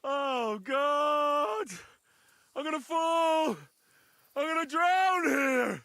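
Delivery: terrified he'll drown; panicked shouting. Acted. Drunk man panicking in water.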